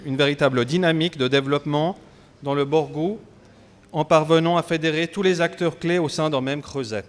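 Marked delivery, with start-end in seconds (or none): none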